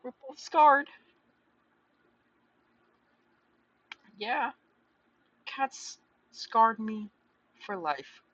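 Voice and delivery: sudden loud dramatic cry; loud outburst. Real, not acted. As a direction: belt it out, slowed and stretched. Breaks out of reading, yells.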